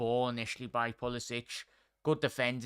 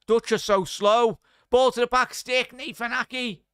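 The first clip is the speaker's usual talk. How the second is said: higher and louder as the chance builds; rising excitement; 9 semitones higher, 10 dB louder than usual. Real, not acted. Streamer calling the US attack to chat, excited.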